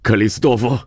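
calling out. A narrator's reading. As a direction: call him back as he goes. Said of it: "曼西沃却叫了声".

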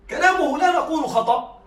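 usual speaking voice

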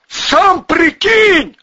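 high, loud, stretched retort; snapping back. A narrator's reading. Volyna retorts to the gunman, snapping back. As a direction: snap back at a shout. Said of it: "огрызнулся Волына".

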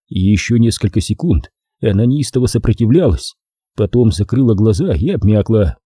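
ordinary delivery, nothing marked

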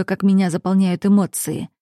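plain reading voice

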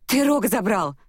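high, abrupt outburst; blurted accusation. A narrator's reading. Heroine accusing him off the point, blurted out. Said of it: "невпопад брякнула я".

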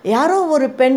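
understated frightened stammering; dazed babbling. A narrator's reading. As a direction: babble it in a lightly acted daze, pitch lifted. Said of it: "என்று குளறினாள் பத்மாவதி".

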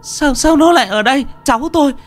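very high, stammering wail; frantic high-pitched pleading; panicked shriek. A narrator's reading. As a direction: shriek for help, stumbling over words. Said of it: "rú lên".

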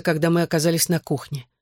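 usual speaking voice

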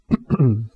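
clearing the throat before a speech; orator's preparatory little cough. Acted. Mourner about to address the crowd, clearing his throat.

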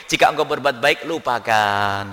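low, slow, solemn quoted maxim; slow reverent recitation of a maxim; quoting Luqman Hakim. Retold or imitated. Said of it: "dikatakan oleh Luqman Hakim".

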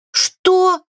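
high, startled query; breathless question. A narrator's reading. Witch stumbling on a step, breathless startled question. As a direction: pitch it right up in alarm. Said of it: "задыхаясь, переспросила".

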